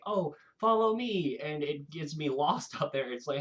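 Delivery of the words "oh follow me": high, coaxing fairy lure; teasing pixie. Retold or imitated. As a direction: lure them along like a giggly pixie. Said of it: "Pixie giggles and is like".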